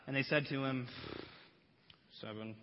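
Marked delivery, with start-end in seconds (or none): 2.2-2.6 soft low mumbled seven; soft sheepish reply; sheepish answer. Acted. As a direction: answer like scolded pupils. Disciples answer quietly.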